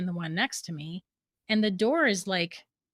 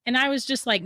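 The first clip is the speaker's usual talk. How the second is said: usual speaking voice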